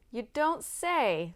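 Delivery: mock amazement. A narrator's reading. Tom teasing the boastful cousin, mock impressed. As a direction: stretch it slowly with dry irony.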